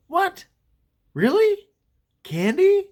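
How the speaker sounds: high-pitched, incredulous questions; surprised kid. Retold or imitated. Kid hearing from his friend about candy, surprised. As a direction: act out a child's disbelief, pitch jumping up.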